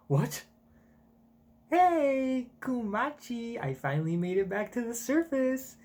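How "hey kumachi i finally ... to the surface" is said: lightly voiced woman, higher; a hint of a tired young woman calling a friend; Ginny greeting Kumachi, lightly voiced; Ginny's voice. Reading Ginny's lines aloud.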